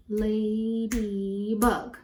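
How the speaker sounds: "Ladybug" slowly in three drawn-out beats; syllable by syllable. Shown as a demonstration. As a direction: count out each syllable of "Ladybug". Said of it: "How many syllables?".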